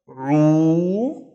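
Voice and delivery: retch-like throat squeeze into a long "oo"; loud, drawn-out demonstration of the ayn; vomiting ayn. Shown as a demonstration. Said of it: "the vomiting one".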